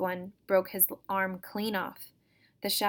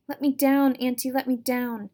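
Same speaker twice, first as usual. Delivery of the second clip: higher, louder pleading little creature; 4 semitones higher, 8 dB louder than usual. Acted. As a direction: beg like a tiny wind-up doll to be set down. Doll creature begs to be let down.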